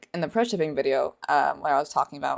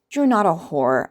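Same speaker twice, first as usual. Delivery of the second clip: ordinary delivery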